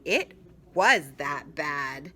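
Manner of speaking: ordinary delivery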